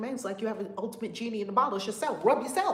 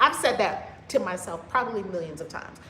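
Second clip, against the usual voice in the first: ordinary talk, nothing marked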